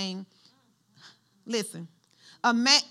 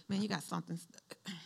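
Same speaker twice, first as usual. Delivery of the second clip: discreet tip; 6 semitones lower, 13 dB quieter than usual. Retold or imitated. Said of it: "let me see, tell you".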